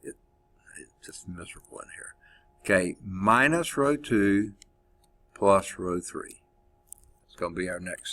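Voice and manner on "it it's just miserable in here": shivering, half whispered; cold and shivering, under breath. Real, not acted. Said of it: "almost shivering it".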